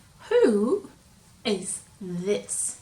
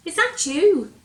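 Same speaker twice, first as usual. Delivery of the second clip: surprised question; 8 semitones higher, 10 dB louder than usual. Acted. Square suspicious.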